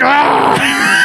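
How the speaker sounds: high-pitched imitation yell; mock scream. Acting out the time machine mishap, high mock scream. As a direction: cry out high, as if zapped.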